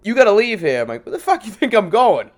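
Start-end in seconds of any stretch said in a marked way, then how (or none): 0.0-0.8 raised, higher mock order; imitated bossy holler. Retold order to leave. 1.1-2.3 loud, higher retold outburst; indignant, raised voice; indignant comeback.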